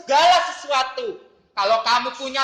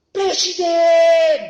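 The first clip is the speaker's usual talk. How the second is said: put-on slow, loud kid voice; proud child shout; 5 semitones higher, 9 dB louder, much slower than usual. Pupil shouting to the teacher his grand dream, slow and proud.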